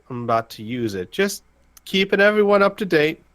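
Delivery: Al radioing in. Acted. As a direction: awkwardly check in, pausing between phrases. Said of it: "he's gonna be like".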